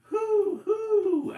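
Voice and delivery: loud high owl hoot. Acted. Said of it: "Owl called".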